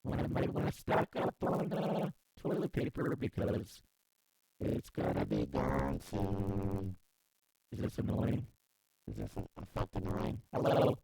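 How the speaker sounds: breathy processed voice; garbled whisper effect; distorted whispery effect. Acted. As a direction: murmur it through an effect.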